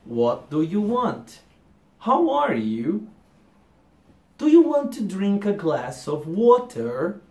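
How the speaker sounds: very slow, over-enunciated; carefully form each word like a beginner; stiff textbook English. Shown as a demonstration. Teacher mocking the textbook English taught at school. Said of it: "O inglês onde você fala".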